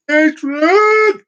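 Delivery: loud, high call across the room; calling Adrian from another room. Real, not acted. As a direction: shout his name to get his attention. Calling out to someone.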